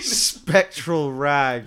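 spectral rag drawn out very slowly; slow savoured phrase. Shown as a demonstration. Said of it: "don't call it that".

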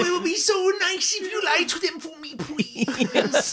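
high, sugary begging voice; mock sweet pleading. GM mocking the player's persuasion attempt, high and pleading. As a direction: coo it like a simpering beggar.

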